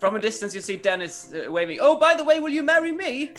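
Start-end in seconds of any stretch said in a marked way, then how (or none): 1.8-3.3 a hammed-up, high, loud put-on accent; Dennis's odd accent. Dennis waving from afar proposing, high silly accent. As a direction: call it out from a distance in a silly accent. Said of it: "I don't know why Dennis got that accent".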